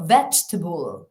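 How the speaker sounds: VEG-tuh-bul with unstressed schwas; Vegetable as VEJ-tuh-bul; model pronunciation. Shown as a demonstration. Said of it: "la sílaba tónica y el resto".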